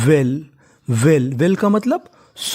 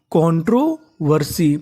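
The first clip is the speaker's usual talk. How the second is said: Controversy said slowly, every syllable clear; slow teaching demo; slower than usual. Shown as a demonstration. Teacher to students, sounding out the word slowly. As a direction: draw controversy out for the class. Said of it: "तो पूरी meaning क्या बनी?".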